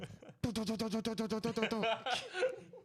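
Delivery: high stuttering laugh; cackling laugh. Real, not acted. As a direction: burst out cackling. Big laugh.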